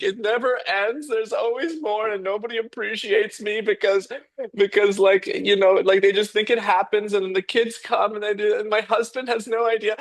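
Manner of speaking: high, whiny mock sob; imitated crying homemaker. Homemaker crying to the interviewer, high mock whine. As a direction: imitate a tearful complaining mum. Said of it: "they're bawling their eyes out".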